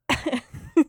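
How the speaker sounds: genuine burst of laughter; natural laugh. Real, not acted. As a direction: laugh for real. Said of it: "I just naturally laughed".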